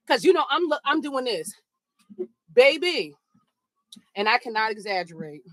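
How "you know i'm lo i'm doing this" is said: ordinary talk, nothing marked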